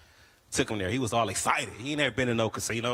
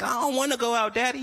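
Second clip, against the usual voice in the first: put-on high whiny girl voice, pitched way up; 12 semitones higher, 3 dB louder than usual. Girls whining to their pimp, high and whiny. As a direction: whine like a reluctant worker to her boss.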